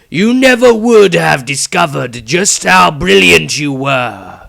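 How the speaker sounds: put-on high, slow, booming old wizard lord; loud booming voice. A narrator's reading. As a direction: boom it slowly from everywhere at once. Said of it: "The Patriarch's voice was loud, coming from all directions".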